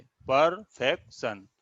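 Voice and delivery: Perfection slowly, पर, फेक, शन apart; short pause after each beat of perfection; split into syllables. Shown as a demonstration. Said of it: "कितने स्वर का उच्चारण". (Perfection को पर-फेक-शन अलग-अलग बोलना)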